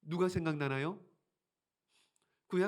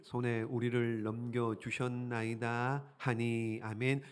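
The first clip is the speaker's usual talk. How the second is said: low, measured reading; lower, steady Bible reading; scripture reading; 4 semitones lower than usual. Acted. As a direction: read the verse aloud solemnly.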